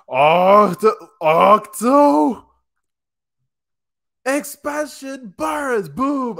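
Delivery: high, drawn-out battle cry; hammed-up, high-pitched special-move call; attack-name shout. Acts out Shoji's attack shout.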